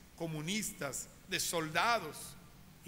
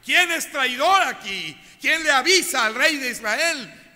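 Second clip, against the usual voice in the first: high, loud furious shout at his men; furious king; 7 semitones higher, 14 dB louder than usual. Acted. The king of Syria shouts at his court, enraged. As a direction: roar out the search for a traitor.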